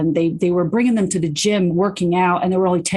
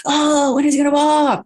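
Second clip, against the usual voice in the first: mock impatient parent; 8 semitones higher, 3 dB louder than usual. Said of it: "you're not getting frustrated".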